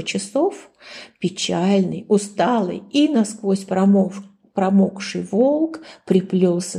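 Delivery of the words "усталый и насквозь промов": ordinary delivery, nothing marked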